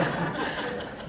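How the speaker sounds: unvoiced laugh; soft real laugh; breathy laugh. Real, not acted. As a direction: laugh quietly at the joke. Laughing after the impression.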